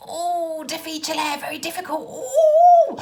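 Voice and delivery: high whiny Italian-tinged wail, ending in a loud squeal; mock driver whining it's too difficult. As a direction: ham up the driver's complaint. Mocking the drivers' excuses.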